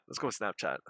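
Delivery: low, soft muttered repeat; low quiet aside. Acted. Repeats the suggestion differently.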